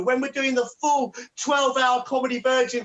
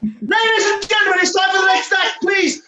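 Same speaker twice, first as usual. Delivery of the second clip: loud, higher showman announcement; announcer; 7 semitones higher, 6 dB louder than usual. Acted. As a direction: build up the act like a game show host. Compere introducing next act.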